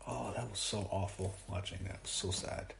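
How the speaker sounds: usual speaking voice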